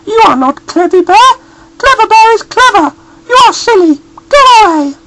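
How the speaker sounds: very high, loud scolding mother bear; high, loud cross mother voice; cross Mummy Bear. A narrator's reading. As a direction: shoo the stranger off the doorstep. Mummy Bear sends him away.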